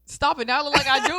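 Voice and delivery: loud, high teased protest; flustered protest. Real, not acted. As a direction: protest loudly at being teased. Laughing protest to co-host's teasing.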